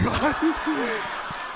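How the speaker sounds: laugh. Real, not acted. Laughs at own joke.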